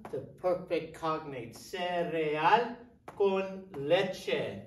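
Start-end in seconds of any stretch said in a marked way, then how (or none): 1.6-4.6 "Cereal con leche" very slowly, each word clear; slow it right down for the class; slow modeling. Shown as a demonstration. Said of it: "teacher models slowly".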